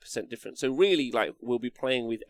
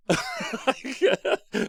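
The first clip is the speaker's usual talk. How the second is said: big burst of laughter; 13 semitones higher than usual. Real, not acted. Laughing at own story.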